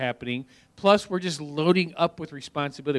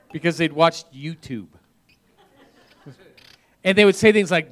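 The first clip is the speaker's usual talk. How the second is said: normal talking voice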